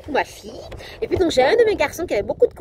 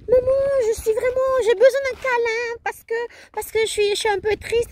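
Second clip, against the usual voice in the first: put-on higher, louder small boy asking for a hug; voicing her small son, pitched up and sad; little boy voice; 11 semitones higher, 4 dB louder than usual.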